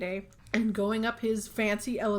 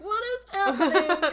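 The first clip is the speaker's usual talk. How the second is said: high, loud, laughing what-is-happening; a laughing shocked outburst at the screen; mock disbelief; 10 semitones higher, 5 dB louder than usual. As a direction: squeal it in baffled disbelief. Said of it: "And I was like".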